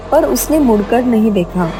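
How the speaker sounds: ordinary delivery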